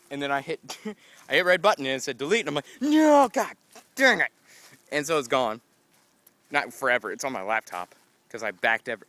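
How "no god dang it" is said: high, loud cry of dismay; mock anguished high yell at the screen; frustrated cry. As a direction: shout it like you just lost everything. Retelling his deleting mistake.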